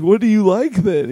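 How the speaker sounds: high, loud baffled ask; incredulous question. Retold or imitated. Comic asking the drunk fan, baffled and loud. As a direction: ask in disbelief. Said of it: "I was like".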